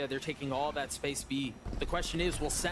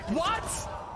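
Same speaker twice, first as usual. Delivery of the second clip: surprised shout; 12 semitones higher than usual. Real, not acted. Caster shocked by a play.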